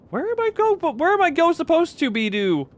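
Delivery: very high, squeaky confused voice; mock bewildered wail. Lost player asking where to go, mock high-pitched bewilderment. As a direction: garble it in a high, helpless whine.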